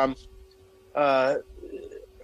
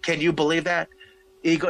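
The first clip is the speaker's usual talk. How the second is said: normal talking voice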